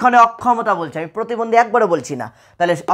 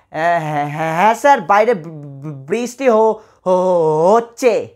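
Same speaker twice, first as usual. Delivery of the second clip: stuttering demo; 2 semitones lower than usual. Shown as a demonstration. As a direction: stammer and repeat the first sounds, with gaps between the words. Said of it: "মানে কি একটু তোতলে তোতলে বলবে".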